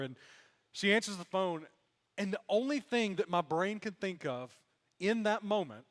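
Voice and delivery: ordinary talk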